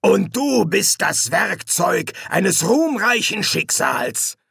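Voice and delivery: high, slow, swelling proclamation; drawn-out villainous fervour; grandiose demon. Acted. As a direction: announce his destiny with pomp. Hastur proclaims Crowley's destiny.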